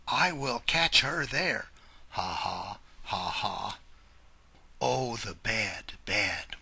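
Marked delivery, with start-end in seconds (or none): 2.1-3.8 low chuckle. Acted. Wolf chuckles to himself.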